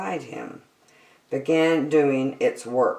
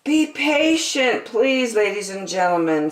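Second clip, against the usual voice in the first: cruise director; 6 semitones higher than usual. A narrator's reading. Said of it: "said the cruise director".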